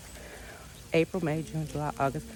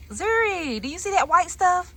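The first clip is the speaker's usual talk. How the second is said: high, sing-song coo to a puppy; pet talk; 9 semitones higher than usual. Real, not acted. Owner cooing at her puppy about the snow, high sing-song.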